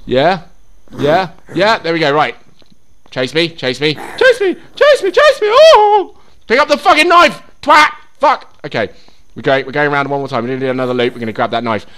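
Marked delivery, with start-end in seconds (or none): 3.9-6.2 very high, panicky shrieked taunt; squealed taunt. Real, not acted. Player luring a zombie after him, squealing. 6.5-8.5 loud, high-pitched frustrated shout; frantic yell. Real, not acted. Player failing to pick up the knife, yelling. Yelling at game while chased.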